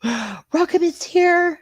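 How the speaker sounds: gasp, then high, slow delighted welcome; light up as a friend arrives; excited greeting. Real, not acted. Host welcoming a favourite viewer to the stream, thrilled.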